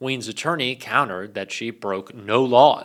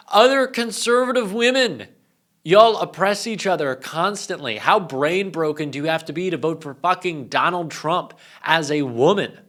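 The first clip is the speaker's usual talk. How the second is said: raised, higher voice in a heated rant; exasperated rant; 4 semitones higher, 3 dB louder than usual. Real, not acted. Ranting at conservative women about oppressing each other, exasperated. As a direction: rant it with punched emphasis. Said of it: "this is a bit of a rant".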